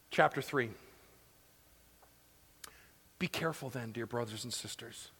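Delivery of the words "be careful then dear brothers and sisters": soft, gentle reading of the verse; tender scripture reading. Acted. Pastor to congregation, soft tender plea. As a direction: read it like a pastor pleading softly.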